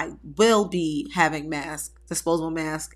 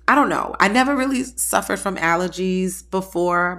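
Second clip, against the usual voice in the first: ordinary talk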